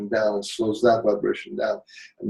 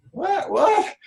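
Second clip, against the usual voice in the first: much higher, disbelieving squeak; shocked disbelief, high and sharp; incredulous what. Real, not acted. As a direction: react as if you can't believe your ears. Incredulous reaction to hell threat.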